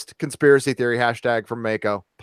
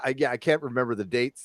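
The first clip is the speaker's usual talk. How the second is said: ordinary talk, nothing marked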